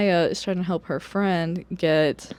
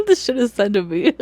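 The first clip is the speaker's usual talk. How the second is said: laughing; 2 semitones higher, 7 dB louder than usual. Real, not acted. Laughing at the funny robot.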